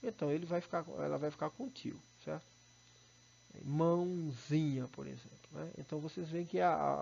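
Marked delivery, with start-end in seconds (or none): 3.6-4.9 "Mãozinha" drawn out slowly, stress on "zi"; slow "Mãozinha" with nasal first syllable. Shown as a demonstration. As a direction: stretch "Mãozinha" syllable by syllable, leaning on ZI. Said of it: "a vogal tônica é o zi".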